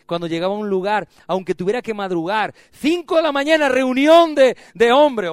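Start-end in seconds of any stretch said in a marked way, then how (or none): 2.7-5.3 high, loud preached cry; loud, high sermon emphasis; preaching shout. Real, not acted. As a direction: belt it out to the crowd. Preaching builds to exclaim.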